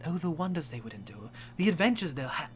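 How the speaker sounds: ordinary talk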